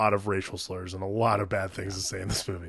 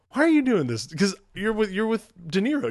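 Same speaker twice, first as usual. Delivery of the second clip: high, loud mock disbelief; hammed-up bewildered objection; incredulous protest; 9 semitones higher, 5 dB louder than usual. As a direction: protest in a squeaky-high, baffled voice. Acts out viewer's disbelief at scene.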